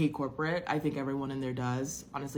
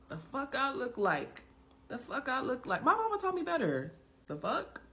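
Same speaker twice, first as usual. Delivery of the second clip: high-pitched, incredulous squeal; mock indignation; 10 semitones higher than usual. Scoffing at making someone else rich, mock indignant. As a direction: protest in a high, comic voice.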